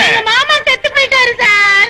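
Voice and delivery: put-on tearful wail; hammed-up grieving cry. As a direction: sob out the bad news. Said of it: "ஏமா அழுகுற?".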